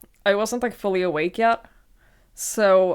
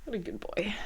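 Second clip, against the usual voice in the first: soft cooing; 12 dB quieter, faster than usual. Real, not acted. Cooing at pet behind her.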